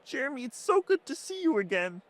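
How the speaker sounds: high, far-off dad voice; fond dad voice. Acted. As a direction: greet your lost son, high-pitched.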